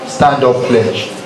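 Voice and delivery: usual speaking voice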